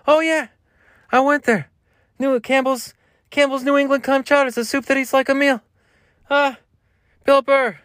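very high, strained comic impression; Bill Burr. Shown as a demonstration. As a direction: push the voice way up high. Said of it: "with Bill Burr".

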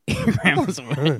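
giggling through the line; laughing tease. Real, not acted. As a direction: tease him while laughing. Teasing friend about grandma.

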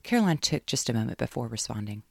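ordinary delivery, nothing marked

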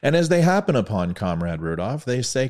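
normal talking voice

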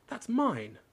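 protesting. A narrator's reading. Harry grabbing back letter.

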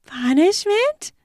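high, slow, wide-eyed question; innocent. A narrator's reading. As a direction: feign innocence, playing along. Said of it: "she asks innocently".

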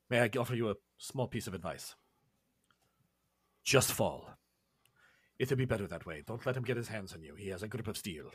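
low, measured voice of a henchman offering advice; solemn Julio. Acted. Julio warning a challenger before the fight, solemn and low. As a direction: speak low and grave, pausing between warnings. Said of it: "Julio steps forward and walks up and he's like".

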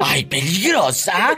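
teasing cry. Real, not acted. Host teasing the caller, slow and playful.

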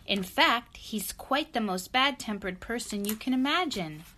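emphatic picture-book reading, key words punched; lively storybook narration. A narrator's reading. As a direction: tell the story with relish for a child. Reads story to child.

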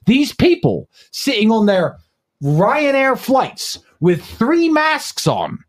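normal talking voice

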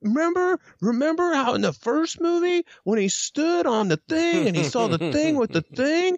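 high-pitched gushing fan voice; mock excited fan. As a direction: squeal it like an over-eager fan. Mocking fan reminiscing about the movie.

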